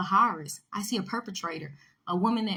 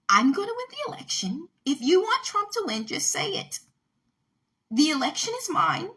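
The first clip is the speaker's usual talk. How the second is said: mock Kamala Harris; 8 semitones higher, 3 dB louder than usual. Mocking Kamala Harris for viewers, in a high hammed-up voice. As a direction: mimic a politician reading her script. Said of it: "like, she just repeats herself".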